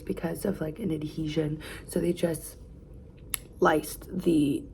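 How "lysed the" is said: usual speaking voice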